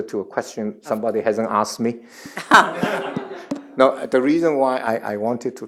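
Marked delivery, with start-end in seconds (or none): 2.1-3.7 amused chuckle at his own joke; a short, high real laugh. Real, not acted. Speaker laughing at his own cheeky request, real laugh.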